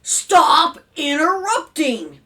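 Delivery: loud, slow, drawn-out shout; father's shout. A narrator's reading. Father cuts off interrupting children, shouting. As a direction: roar each word at the kids. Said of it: "Mister Franklin shouted".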